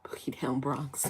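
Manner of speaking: whispered. Shown as a demonstration. Repeating a viewer's borough name, whispered to herself. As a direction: whisper "Boogie Down Bronx" breathily, no voice. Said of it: "That's funny".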